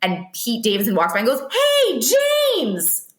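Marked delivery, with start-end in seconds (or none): 1.5-3.1 higher, calling a name across the street. Retold or imitated. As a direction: hail him from down the street. Pete Davidson calling out James.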